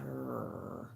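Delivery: low quiet rumbling dog growl. Retold or imitated. The dog grumbling at the boyfriend, a low quiet growl. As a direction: growl softly like a wary dog. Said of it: "Pac-Man will just be sitting".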